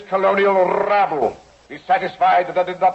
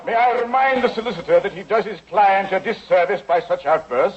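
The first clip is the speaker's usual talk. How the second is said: normal talking voice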